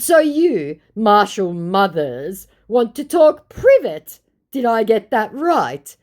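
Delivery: high, loud, slowed incredulous mockery; mocking. Vlogger mocking the scammer's request, high, loud and slow. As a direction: stretch it out to mock him.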